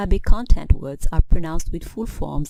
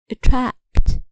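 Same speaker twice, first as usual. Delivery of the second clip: "attract" stressed on its second syllable, higher pitched; 5 semitones higher than usual. Shown as a demonstration. Teacher showing verb stress to learners, "attract" stressed on second syllable. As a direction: hit the last syllable of "attract". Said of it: "Verbs stress the second syllable".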